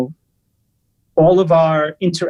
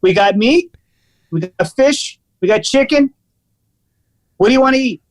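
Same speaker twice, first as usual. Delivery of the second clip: higher mock brusque waiter rattling off options; aggressive waiter; 6 semitones higher than usual. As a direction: list the food impatiently, hammed-up. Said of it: "Really super aggressive".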